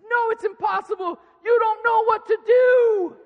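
put-on high, loud shout as a stubborn kid; little son shouting at dad he can't help; angry child shout. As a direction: bellow like a furious three-year-old. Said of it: "he's so angry".

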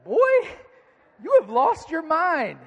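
hammed-up high shout, words stretched out; outraged dad. Dad answering his blaming son, outraged high shout. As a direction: erupt in loud, high comic disbelief.

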